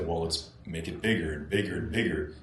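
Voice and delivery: ordinary talk, nothing marked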